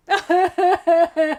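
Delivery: real laugh breaking out; high laugh. Real, not acted. Laughing at crying together.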